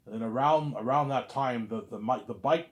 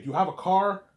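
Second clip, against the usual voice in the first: higher and louder, pressing; urgent question; 7 semitones higher, 7 dB louder than usual. Retold or imitated. Messiah urgently asking the narrator about a car, loudly. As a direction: ask it like time is running out.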